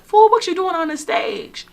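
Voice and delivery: high, loud mock scolding. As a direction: snap at him like an annoyed wife on stage.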